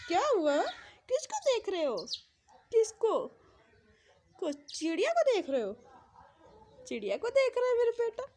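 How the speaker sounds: delighted cooing to an infant. Real, not acted. Mother playing with her baby.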